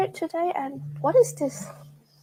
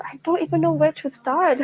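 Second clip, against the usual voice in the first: ordinary talk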